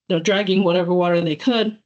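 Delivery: normal talking voice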